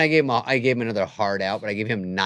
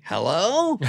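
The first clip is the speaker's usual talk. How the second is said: high, loud phone "hello"; demanding caller wanting an answer, acted out; calling out; 9 semitones higher, 6 dB louder than usual. Comedian acting friend's three a.m. call, calling out loudly.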